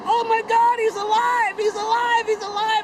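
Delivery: high, loud joyful cries; overjoyed shout. Retold or imitated. One boy seeing his friend alive, high joyful shouting. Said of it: "one guy says".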